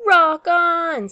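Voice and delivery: high, loud rocker's shout; punchy, delighted storybook shout; captain's excited cheer. A narrator's reading. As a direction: cheer the drummer on.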